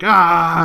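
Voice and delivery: imitating his mock scream; a short playful yell; retold yell. Dad joins the family screaming game with a yell. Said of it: "And then he's like".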